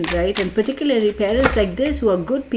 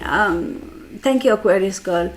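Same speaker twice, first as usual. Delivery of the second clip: ordinary delivery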